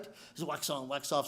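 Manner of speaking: lightly voiced sensei, low and hushed; quiet, low instruction. Sensei showing the chore, low and soft.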